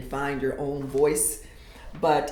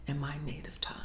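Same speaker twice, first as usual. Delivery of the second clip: softer final whisper, fading out; 9 dB quieter, faster than usual. Acted. Poet ending the poem softly in a whisper. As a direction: let the last words vanish in a whisper.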